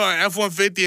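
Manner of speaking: ordinary talk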